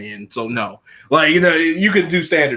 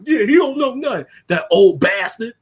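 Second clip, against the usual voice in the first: higher sneering sidekick voice; hype man jeering; 9 semitones higher, 5 dB louder than usual. Retold or imitated. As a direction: jeer at the old man to back your boss. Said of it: "his little entourage".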